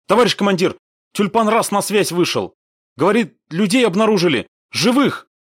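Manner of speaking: high, loud, excited signaler; urgent report. A narrator's reading. Signaler telling commander about found survivors, excitedly. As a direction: burst in with astonishing news.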